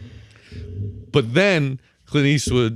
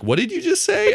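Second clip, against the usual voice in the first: much higher, incredulous what did you just say; shocked disbelief. Retold or imitated. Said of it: "And then you're like".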